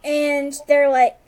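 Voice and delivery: normal talking voice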